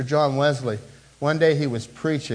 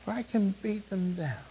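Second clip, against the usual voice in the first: hushed contrast; 4 semitones higher, 8 dB quieter than usual. Shown as a demonstration. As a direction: let the line sink away. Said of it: "How about your spouse?".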